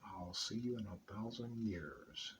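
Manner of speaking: low, soft and slow, drawn out like a threat; fallen angel's voice. Retold or imitated. Fallen angel taunting Clint as he drops into the pit, low and slow. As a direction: let each word sink in quietly. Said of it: "That's the way he talked".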